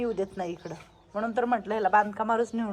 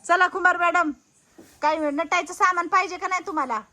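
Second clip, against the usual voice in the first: playful call, higher and louder; loud teasing; 7 semitones higher, 6 dB louder than usual. Real, not acted. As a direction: tease her loudly across the room. Calling the elder loudly.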